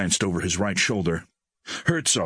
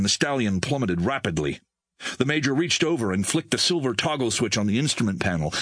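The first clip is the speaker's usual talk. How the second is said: plain reading voice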